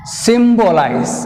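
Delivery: Symbolize stretched out, stress on Sym; slow stressed demo. Shown as a demonstration. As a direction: say Symbolize slowly, leaning on Sym. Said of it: "take stress on the third syllable".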